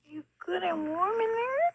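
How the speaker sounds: high, tender cooing voice. Real, not acted. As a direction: coo sweetly at the kitten. Cooing to the kitten.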